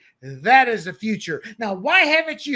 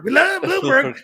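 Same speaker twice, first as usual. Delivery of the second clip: laughing cheer; 7 semitones higher, 5 dB louder than usual. Retold or imitated. Residents cheering, retold.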